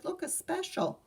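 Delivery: high, eager pointing out; excited. A narrator's reading. Sister pointing at the newspaper ad, high and thrilled. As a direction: squeal it like a thrilled kid. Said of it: "she said".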